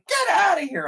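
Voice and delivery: high, loud "get outta here" in mock disbelief; disbelieving shout. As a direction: blurt out mock astonishment. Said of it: "they're like".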